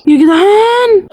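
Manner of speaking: high, slow, tearful wail; whining in dread; frightened wail. Real, not acted. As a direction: stretch it out like a plea for help. Phone rings in scary game.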